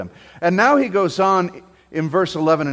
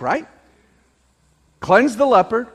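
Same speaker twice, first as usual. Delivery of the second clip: ordinary delivery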